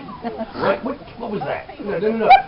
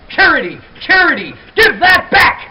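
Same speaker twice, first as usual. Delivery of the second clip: acted parental yell, higher and louder; yelling at a child; 7 semitones higher, 7 dB louder than usual. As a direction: call out sharply to a misbehaving kid. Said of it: "yelling at your child and yelling things like".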